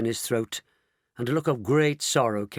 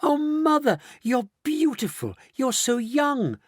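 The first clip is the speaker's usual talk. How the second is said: high-pitched, delighted gushing; marvelling; 14 semitones higher than usual. Acted. Daughter to her suddenly younger mother, marvelling. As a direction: gush in a much higher voice. Mrs Bucket marvels at rejuvenated parents.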